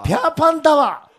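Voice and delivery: loud, high mock Nagoya dialect. Shown as a demonstration. Comedian echoes partner's Nagoya dialect, high and loud. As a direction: ring it out in exaggerated Nagoya dialect. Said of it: "名古屋弁に入れた場合、".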